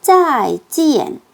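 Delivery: 짜이 and 찌엔 each dropped steeply from high; two fourth tones. Shown as a demonstration. Teacher models the goodbye, 짜이 찌엔 in two falling tones. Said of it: "사성으로".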